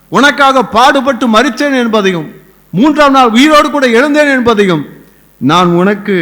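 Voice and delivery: high, loud, impassioned proclamation; full-force preaching. Acted. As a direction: build to a ringing, urgent peak. (உரத்த ஆவேசப் பிரசங்கம்)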